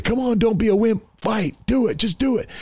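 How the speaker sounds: high, strained imitation of hecklers; goading. Crowd urging people to fight, goading. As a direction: egg them on like a fight crowd.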